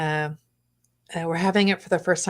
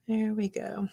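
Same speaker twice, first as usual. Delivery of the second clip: whispering; 3 semitones higher, 6 dB quieter than usual. Real, not acted. Whispering while painting.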